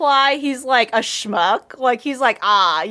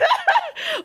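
hysterical laugh. Real, not acted. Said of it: "I'm hysterical about this".

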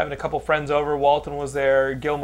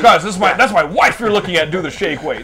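louder, half-laughing protest; mock outrage; 6 semitones higher, 7 dB louder than usual. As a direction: scold the perverts, laughing. Mock outraged husband defending wife.

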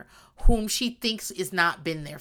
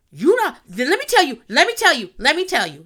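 heated repetition; 5 semitones higher, 6 dB louder than usual. Acted. Ranting building emphasis.

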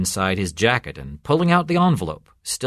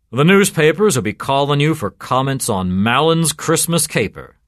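loud, pitched-up sardonic cop; 5 semitones higher, 6 dB louder than usual. A narrator's reading. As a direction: needle him with pointed mock helpfulness. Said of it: "said Sergeant Benway sardonically".

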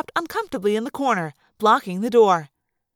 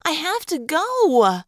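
slow, higher-pitched whine; whined; 4 semitones higher, slower than usual. A narrator's reading. Woman outside the occupied bathroom, whined. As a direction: drag it out in a pleading whine. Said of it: "a woman's voice whined".

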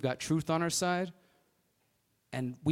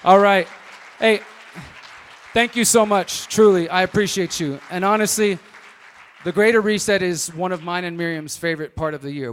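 loud; 3 semitones higher, 13 dB louder than usual. Real, not acted. Addressing crowd loudly after talk.

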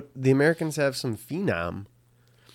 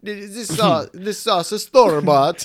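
high, slow, louder put-on accent; mock Italian-style accent; 7 semitones higher, 6 dB louder, slower than usual. Friend joking in a hammed-up accent. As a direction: ham up a chef's accent. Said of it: "What's their accent?".

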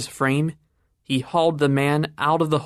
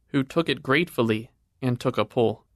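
usual speaking voice

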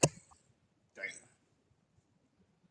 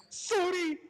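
high timid apology; a tiny embarrassed sorry; squeaky sorry. Retold or imitated. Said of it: "he's like".

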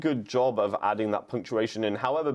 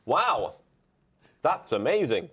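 exclamatory wow; 4 semitones higher, slower than usual. Shown as a demonstration. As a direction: say it with big exclamatory energy. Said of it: "you try to do it with your voice".

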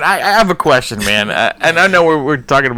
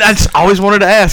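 ordinary delivery

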